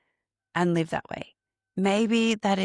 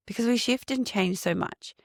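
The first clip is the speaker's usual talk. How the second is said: ordinary delivery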